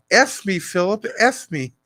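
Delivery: high breathy mock queen; a hint of a posh old queen; Queen Elizabeth impression. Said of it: "hear Queen Elizabeth go".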